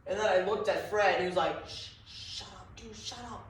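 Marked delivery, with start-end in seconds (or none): 1.7-3.5 urgent whispered shush; hushed whisper. Retold or imitated. Fred hushing his friend so the wife won't hear, in an urgent whisper. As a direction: hiss it under your breath to hush him.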